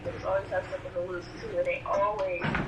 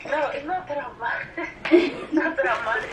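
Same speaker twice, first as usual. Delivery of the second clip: laughing protest; 4 semitones higher, 6 dB louder than usual. Real, not acted. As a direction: protest through real laughter, raised pitch. Laughing protest at being teased.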